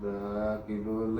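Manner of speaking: slow, low, soft repetition; writing it down. Acted. Inspector noting down Raghu's weight, slowly and softly. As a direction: say it at writing speed, half to yourself. Repeating details while writing them down.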